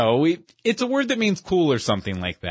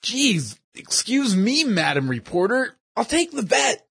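mock respect; 8 semitones higher than usual. Bronson mocks Cindy's self-importance.

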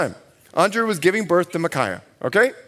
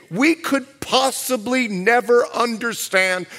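ordinary delivery

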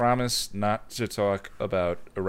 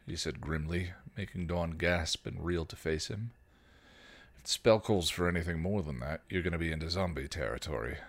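story narration; 3 semitones lower, 4 dB quieter than usual. A narrator's reading. Reads fan fiction aloud.

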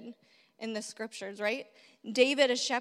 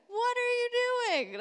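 a high, hurt what-are-you-doing; mock-offended younger brother; indignant disbelief; 10 semitones higher than usual. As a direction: pitch jumps up in stung disbelief. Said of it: "And David's like".

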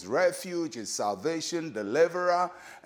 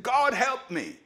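higher-pitched cry for help; mild imitated shout; 8 semitones higher than usual. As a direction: call out to God in a raised pitch, without real shouting. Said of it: "raised his voice and shouted".

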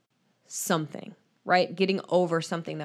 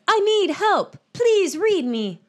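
high, loud, drawn-out plea; imagined letter writer; 13 semitones higher, 5 dB louder, much slower than usual. Acted. Reading an urgent email subject line aloud, hammed-up plea. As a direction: plead it slowly in a raised put-on voice. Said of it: "I imagine that's her voice".

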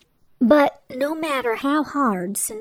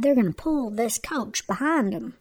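usual speaking voice

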